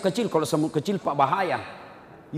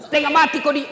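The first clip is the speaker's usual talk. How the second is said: angry mother; 11 semitones higher, 9 dB louder, faster than usual. Retold or imitated. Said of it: "Marah mamanya".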